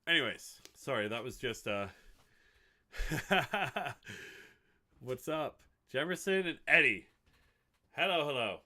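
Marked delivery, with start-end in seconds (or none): 2.9-4.5 loud, high burst of laughter; rapid cackling laugh; real laugh. Real, not acted. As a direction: crack up after your own bit. Cracks up after the fake soundbite.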